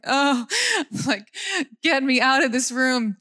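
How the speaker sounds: half-laughing plea to escape; laughing mock despair. Bored wife laughing at stock-market talk. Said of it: "And I'm like".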